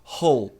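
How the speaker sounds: "Role" said like "hole"; breathy H in place of R in "Role"; mispronounced R. Shown as a demonstration. Teacher imitates learners' error, H sound for R. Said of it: "pronunciar o R como R".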